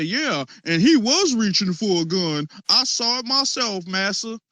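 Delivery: mock servile eyewitness. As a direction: imitate an eager informer pleasing the master. Mocking a servile eyewitness quote.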